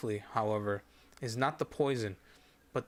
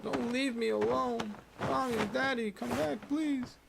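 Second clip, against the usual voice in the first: put-on high, tearful girl's voice begging; a frightened child begging not to be left; pleading young girl; 12 semitones higher than usual. Dying girl calls for her parents.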